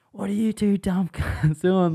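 high, slow, loud boss yell. Retold or imitated. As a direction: yell at the boys like an angry boss. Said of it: "yelling out to him".

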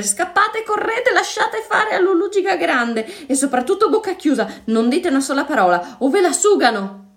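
high, urgent voice, fleeing; panicked warning. A narrator's reading. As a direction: warn them while running for your life. Panicked warning while running away. (avvertimento in preda al panico)

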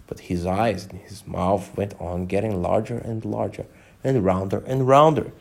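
usual speaking voice

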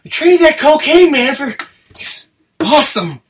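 high, loud, hammed-up TV character; mock character quote. Acts out a drunk TV character's line.

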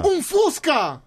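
high-pitched spotting cry; excited sighting. Pretend. Teasing cohost with a sighting, excited high cry.

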